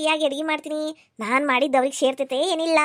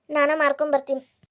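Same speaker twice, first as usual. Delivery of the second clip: normal talking voice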